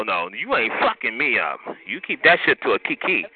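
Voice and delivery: ordinary talk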